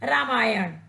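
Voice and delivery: रामायण said with a retroflex ण at the end; रामायण, ending on a clear retroflex ण; correct pronunciation. Shown as a demonstration. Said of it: "शुद्ध शब्द होगा". (शुद्ध उच्चारण: रामायण, अंत में मूर्धन्य ण)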